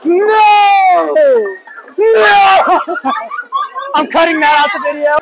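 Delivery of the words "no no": loud, high shout of dismay. Real, not acted. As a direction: yell it at the bad roll. Bowling miss, shouting.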